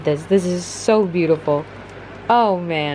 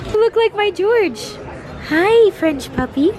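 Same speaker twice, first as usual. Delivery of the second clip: cooing at a puppy; 12 semitones higher, 3 dB louder than usual. Real, not acted. Vlogger greeting a French puppy in high baby talk.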